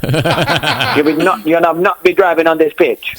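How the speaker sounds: hammed-up Jamaican banter; Jamaican accent. Shown as a demonstration. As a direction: put on a lively Jamaican accent.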